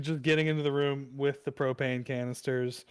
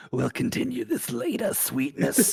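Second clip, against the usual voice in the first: high, slithery Snake villain voice; sly sticky chameleon; 10 semitones higher, 3 dB louder than usual. Acted. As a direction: purr it like a sly reptile. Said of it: "Please do that line in the Snake voice".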